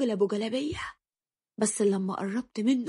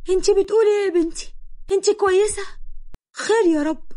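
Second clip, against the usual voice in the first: alarmed mother; 8 semitones higher, 4 dB louder than usual. A narrator's reading. Worried mother to her daughter.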